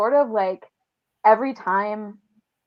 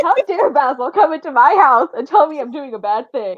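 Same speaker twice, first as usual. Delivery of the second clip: indignant rant, laughing and louder; laughing mock outrage; 6 semitones higher, 8 dB louder than usual. Friend voicing Dorian's rant, laughing loudly. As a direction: rant as Dorian while cracking up. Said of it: "he's like".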